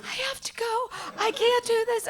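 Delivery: high, strained, barely-there voice; squeaky whisper. Retold or imitated. Leaving the meeting, voice high and strained. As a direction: squeeze the words out high and small. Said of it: "Like, whispering".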